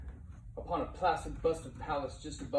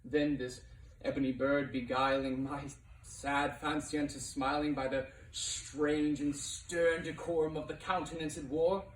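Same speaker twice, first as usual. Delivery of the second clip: plain reading voice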